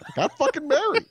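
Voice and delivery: exasperated outburst. Retold or imitated. Exasperated retort in retold chat.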